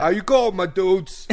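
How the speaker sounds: high, loud mock New York greeting; hammed-up New York tough-guy accent; Sylvester Stallone. Host greeting his dudes, loud put-on New York accent. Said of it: "Sylvester Stallone".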